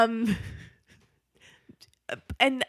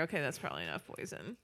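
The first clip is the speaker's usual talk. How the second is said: much softer, quick low mutter; quieter; 7 semitones lower, 17 dB quieter, faster than usual. Retold or imitated. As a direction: drop it to a hushed aside. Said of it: "says a little bit quieter".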